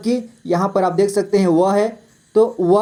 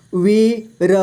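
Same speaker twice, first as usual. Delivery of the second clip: वीर split into वी, र; syllable by syllable; much slower than usual. Shown as a demonstration. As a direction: sound out वी and र separately in वीर. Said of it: "इस तरह से आपको". (वीर को तोड़कर: वी, र)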